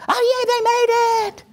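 very high fan squeal; ecstatic cheering. Acted. Fans cheering that the team made it, high ecstatic squeal.

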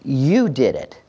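"you" leaned on, the rest falling; stress on YOU. Shown as a demonstration. Teacher crediting the child, stress on "you". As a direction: put the emphasis on "you". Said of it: "put the emphasis on the child".

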